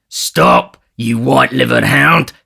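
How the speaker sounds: loud, rough yell after someone; angry shout. A narrator's reading. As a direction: bellow contempt at a fleeing man. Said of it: "shouting after Toby Crackit".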